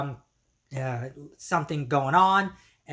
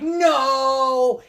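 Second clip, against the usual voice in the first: long, high, loud mock roar; drawn-out enraged cry, imitated; super angry; 7 semitones higher, 5 dB louder than usual. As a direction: yell it out in acted fury. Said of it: "super angry. And he's like".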